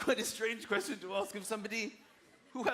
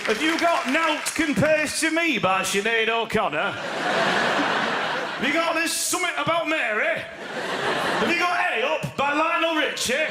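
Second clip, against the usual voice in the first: loud, high hammed-up Yorkshire asks; mock Yorkshire customer; 7 semitones higher, 8 dB louder, slower than usual. Customers in HMV queue, loud mock Yorkshire. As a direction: shout the questions in mock Yorkshire.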